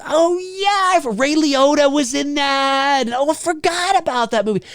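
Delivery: high mock voice of a viewer who just remembered; sudden remembering. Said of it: "you're like".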